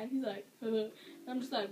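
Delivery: ordinary talk, nothing marked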